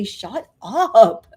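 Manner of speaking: loud, drawn-out mock telling-off. Speaker to the negative voice in another body, loud mock telling-off. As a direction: shut the nagging voice down playfully. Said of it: "put that voice in another body and say".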